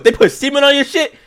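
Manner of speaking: high, far-off shocked squeak; shocked disbelief. Retold or imitated. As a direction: voice shoots way up in shock. Doctor's shocked reaction.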